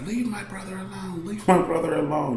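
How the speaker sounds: little sister screaming at the attacker; imitating sister screaming. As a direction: plead with him to leave her brother alone. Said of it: "just screaming".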